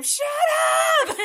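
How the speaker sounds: exasperated, drawn-out scream; big hammed-up yell; mock scream. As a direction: scream it like you're fed up with barking.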